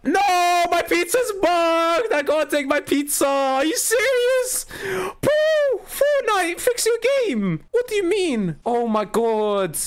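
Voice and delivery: very high-pitched, drawn-out screech of outrage; outraged wailing. Real, not acted. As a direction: shriek in disbelief at the broken game. Raging at bugged pizza.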